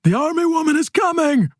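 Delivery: high-pitched yell of alarm; yelled warning. Acted. Casper warns Pelayo as gunshots ring out, yelling. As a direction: yell a warning to your partner. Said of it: "and Casper yells".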